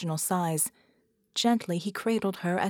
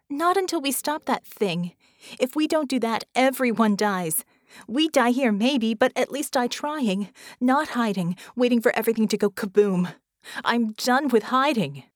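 raised voice, high and forceful; impassioned protest; 4 semitones higher, 5 dB louder than usual. Acted. Vicky refuses to hide from the bomb, fiercely insistent. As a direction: argue back with mounting force. Heated argument over fleeing the bomb.

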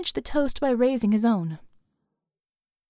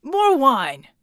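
loud, high call for more; drunken shout; 4 semitones higher, 6 dB louder than usual. A narrator's reading. Tipsy Red calling to Mel, a loud drunk shout. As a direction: bellow it across the bar. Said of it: "Red shouted".

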